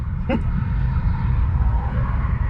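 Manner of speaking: breathy laugh. Real, not acted. Laughing at own impression.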